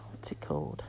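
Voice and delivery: soft unvoiced whisper. Real, not acted. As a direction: whisper it to yourself. Whispering in a shop aisle.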